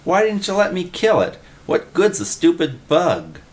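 high, whiny complaint; whining. A narrator's reading. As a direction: plead like a sulky kid. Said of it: "Willie whined".

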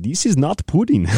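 high, put-on voice of an old man, indignant; old guy's voice. Old guy at the table objecting in a high put-on voice. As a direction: squeak it out like a cranky old guy. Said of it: "he's like".

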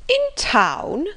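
high-pitched, incredulous echo; astonished cry. A narrator's reading. Mrs Jennings hearing the Colonel must leave, astonished. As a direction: throw it back at him, pitch leaping up. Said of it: "cried Missus Jennings".

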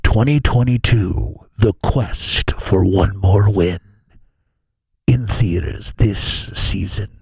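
low, loud, slow trailer voice; deep epic movie trailer narrator. Acted. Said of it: "put it in the movie trailer voice guy".

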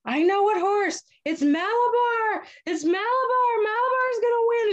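very high-pitched mock cries; frenzied trance. Boy on rocking horse to his mom, high frenzied trance cries. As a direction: imitate a possessed boy crying out a revelation. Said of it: "like, in a trance".

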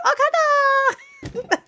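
very high, very slow, long-drawn Okada; swooning fangirl. Retold or imitated. Imitating swooning fangirls at Wrestle Kingdom, high and drawn out. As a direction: melt over the name like a swooning fangirl. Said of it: "the fangirls in, like, Wrestle Kingdom".

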